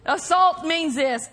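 ordinary delivery

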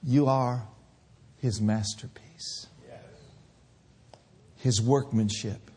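hushed reverent sermon close; tender preaching. Acted. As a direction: gently draw them into awe. Soft closing reflection.

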